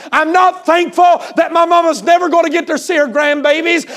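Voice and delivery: high, loud, shouted protest; angry outburst. Retold or imitated. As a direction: hurl it out like a grieving daughter's protest. Grieving daughter refuses to give thanks.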